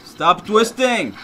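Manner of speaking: high, slow, loud scolding; drawn-out, exasperated call; exasperated command. Real, not acted. Scolding the game character.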